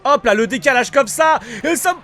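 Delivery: high, loud burst of hype; excited play calling. Real, not acted. Player showing off his favourite dribble, excited shout. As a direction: call the move as it happens. Commente son dribble en pleine action.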